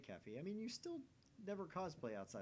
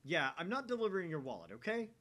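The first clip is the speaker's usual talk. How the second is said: shouting; 6 semitones higher, 8 dB louder than usual. Acted. Yelling at caller on phone.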